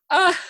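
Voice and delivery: very high imitated wail; put-on high sob; mock wailing. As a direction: mimic a woman bawling. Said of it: "all I could hear was her wailing".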